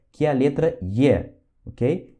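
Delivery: ordinary delivery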